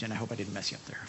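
a quick apologetic whisper to the preacher; whispered aside. Real, not acted.

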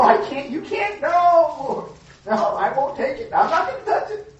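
hammed-up refusal of the Lord's help; proud refusal. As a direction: play a stubborn sinner pushing the rope away. Acting a sinner refusing help.

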